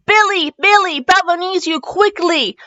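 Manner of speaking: urgent high-pitched calling out; hollering. Acted. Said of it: "Charlie started hollering".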